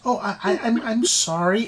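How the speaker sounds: a hint of a flustered creature; flustered little dragon. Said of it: "and Bim says".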